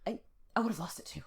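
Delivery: a confiding whispered aside. Real, not acted. Host admitting to her co-host, whispered. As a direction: drop to a whisper for yourself.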